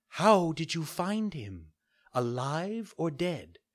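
high, gentle fairy voice; a kind fairy giving a calm order. Acted. Fairy asks falcon.

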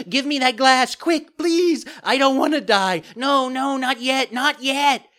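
very high, loud terrified puppet; panicked cry. A narrator's reading. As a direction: beg frantically for your life. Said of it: "the marionette cried out".

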